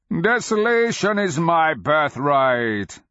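slow, loud, high creature voice; beast's proclamation. A narrator's reading. Dragon answering its taunter, loud slow roar. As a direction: proclaim it slowly as a proud dragon.

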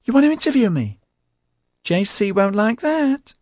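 put-on very high piercing girlish voice; high-pitched, squeaky. A narrator's reading. Tiger Pansy frets about J C, high and squeaky. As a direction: squeak it, far above normal pitch. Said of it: "a high-pitched sound drilling through".

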